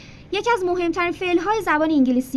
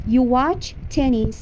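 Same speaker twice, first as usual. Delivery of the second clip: slow teacher demo of the sentence; slow model sentence; 2 semitones lower, much slower than usual. Shown as a demonstration. Teacher modelling English for Persian students, slow. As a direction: say it slowly for repetition. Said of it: "تو تنیس".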